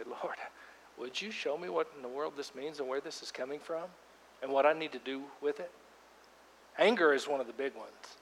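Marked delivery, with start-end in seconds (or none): 0.0-5.7 quiet prayer. Acted.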